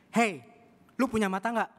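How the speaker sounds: loud and high, furious at the man who bumped him; angry shout. Retold or imitated. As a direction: yell at him for not looking. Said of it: "Dia marah besar". (bentakan marah yang keras dan tinggi)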